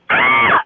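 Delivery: high loud yelled ah; son's stim scream. Retold or imitated. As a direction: mimic the kid's sudden scream. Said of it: "lately he's been like".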